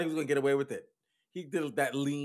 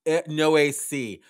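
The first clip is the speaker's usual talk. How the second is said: loud disgusted complaint; 2 semitones higher, 11 dB louder, slower than usual. Acted. Passengers stuck in the stench, loud disgusted griping. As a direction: complain loudly, dragging out the words.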